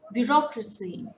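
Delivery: loud Bureaucracy with ROC punched; stress on second syllable. Shown as a demonstration. Teacher showing a four-syllable word, second syllable stressed. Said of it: "Stress falls on the second syllable".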